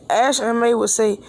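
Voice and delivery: ordinary delivery, nothing marked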